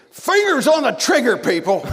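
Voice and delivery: loud high shout, imitating a giant crew boss; sudden high-pitched yelled command; imitated scream to crew. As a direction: bellow it like ordering a whole film crew. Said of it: "he just screams to the crew".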